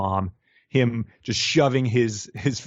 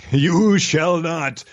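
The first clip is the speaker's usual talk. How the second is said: slow, booming wizard proclamation; Gandalf voice; 6 semitones higher, 5 dB louder, much slower than usual. Pretend. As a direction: thunder it out like a wizard. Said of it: "with her Gandalf voice on".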